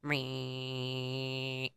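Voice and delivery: long, low droning buzz; steady buzzing noise made by mouth; imitated razor buzz. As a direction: mimic an electric shaver running. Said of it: "you just hear".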